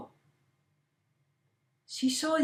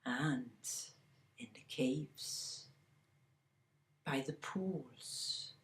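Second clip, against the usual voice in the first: hushed, secretive whisper; 6 semitones lower, 15 dB quieter than usual. A narrator's reading. Storyteller whispers about the lovers' hiding spots.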